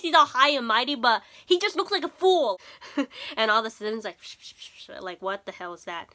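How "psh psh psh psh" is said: breathy psh sounds without voice; soft psh psh hisses; imitated hissing noise. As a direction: mimic the odd spraying noise.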